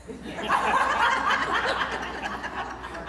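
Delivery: real laugh rising high and airy. Real, not acted. Coach laughs at her horse joke, high real laugh. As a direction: let a high laugh burst out.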